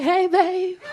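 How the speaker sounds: put-on high, sweet greeting to a boyfriend; buttering up a boyfriend; sweet-talking. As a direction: ease in with a high-pitched hello. Timid approach to boyfriend.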